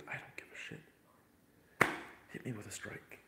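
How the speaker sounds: slow whispered shrug; whispering. Real, not acted. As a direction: whisper it slowly, unbothered. Aside about copyright strikes.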